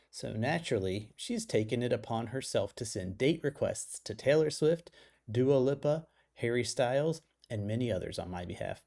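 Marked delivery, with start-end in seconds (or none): none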